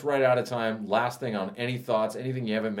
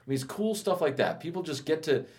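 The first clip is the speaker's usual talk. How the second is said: normal talking voice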